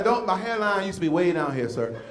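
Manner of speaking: usual speaking voice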